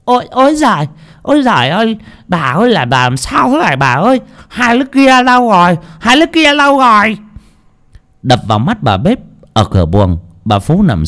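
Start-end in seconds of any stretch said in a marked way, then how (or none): 0.0-4.2 high, shrill-free panicked wail of a cook; frantic high-pitched alarm; panicked cry. Acted. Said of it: "tá hỏa kêu lên". 4.5-5.8 panicked call. Acted. Cook repeats panicked call. 6.0-7.2 panicked call. Acted. Cook repeats panicked call.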